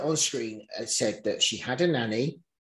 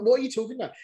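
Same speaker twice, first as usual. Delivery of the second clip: high, disbelieving question; retold baffled reply to a friend; incredulous; 11 semitones higher, much faster than usual. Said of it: "I'm like".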